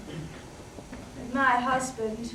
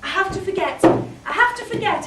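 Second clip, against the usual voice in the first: frantic self-talk; 5 semitones higher, 7 dB louder than usual. Acted. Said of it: "I said to myself".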